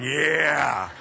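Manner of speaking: drawn-out mock guy "yeah"; guys' reaction. Preacher mimics guys hearing a love verse, drawn-out approval. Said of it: "The guys are going".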